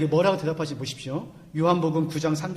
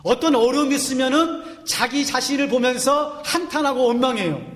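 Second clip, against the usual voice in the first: higher, louder preaching at full force; fervent preaching; 6 semitones higher, 4 dB louder than usual. Acted. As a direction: drive the point home to the congregation. Pastor preaching to congregation.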